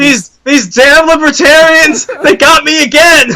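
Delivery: high shouted father's rant; hammed-up high shouting father; mock angry dad. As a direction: rage at the libertarians like a cartoon dad. Dad shouting about libertarians.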